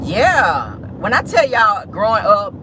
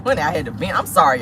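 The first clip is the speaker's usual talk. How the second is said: ordinary talk, nothing marked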